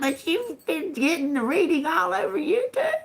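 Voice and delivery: high, breaking voice of disbelief; incredulous squeal. Real, not acted. Mocking disbelief.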